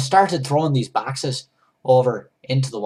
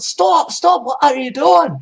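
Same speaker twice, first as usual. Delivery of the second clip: much higher, much louder shouted stop; guard shouting from the gangplank; yelling; 11 dB louder, slower than usual. Retold or imitated. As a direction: bellow it at the thief.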